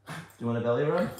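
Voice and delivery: fast, eager rattle of the question; rapid-fire. Real, not acted. Owner rushing the belly rub offer to her dog, fast. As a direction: blurt it out quickly, excited.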